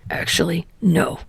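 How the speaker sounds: ordinary delivery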